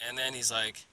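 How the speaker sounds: ordinary talk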